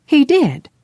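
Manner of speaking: put-on a young woman quick to agree; eager insistence. A narrator's reading. Kay insisting earnestly.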